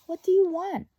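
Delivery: put-on high, loud child's cry into the dark; startled boy. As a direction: call out like a scared little boy. Laszlo voiced in read story.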